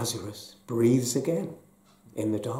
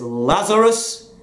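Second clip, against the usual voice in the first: a loud commanding call, slow on the name; ringing command to the dead; 5 semitones higher, 8 dB louder, slower than usual. Acted. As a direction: call him out of the tomb. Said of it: "he speaks in a loud voice".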